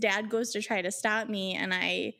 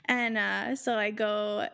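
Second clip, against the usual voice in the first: ordinary delivery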